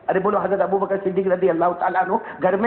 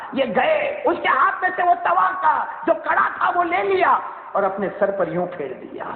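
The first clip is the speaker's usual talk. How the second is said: preaching at full force; 11 semitones higher than usual. Acted. Climax of story shouted.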